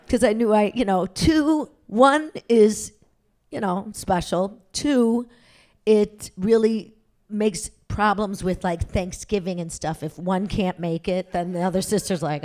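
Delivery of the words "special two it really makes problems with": ordinary talk, nothing marked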